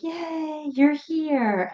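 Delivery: high, squeaky voice of a happy dog; a giddy puppy greeting the sitter; excited dog voice. Pretend. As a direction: play the dog thrilled to see you. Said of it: "they're just like".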